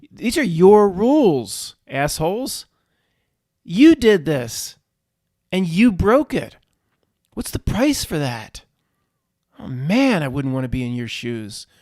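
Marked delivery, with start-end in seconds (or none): 0.1-8.6 slow, high, loud indignant accusation; angry accusation. Real, not acted. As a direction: hammer each accusing phrase, drawn out. Accusing the rule makers in rant.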